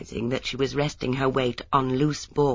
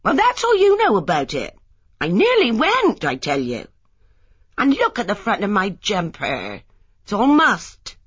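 much higher, louder, aggrieved; upset young wife scolding her husband; indignant complaint; 5 semitones higher, 4 dB louder than usual. Acted. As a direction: let him know she nearly went. Lily indignant after fall.

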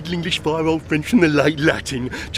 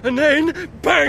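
shouted bang; 10 semitones higher, slower than usual. Retold or imitated. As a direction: yell the shot as you relive it. Reliving the shooting.

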